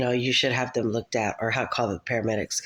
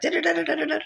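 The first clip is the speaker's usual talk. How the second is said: high rattling da-da-da typing noise; imitated busy typing; faster than usual. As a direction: mimic furious computer work in rapid syllables. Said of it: "I was on the computer, like".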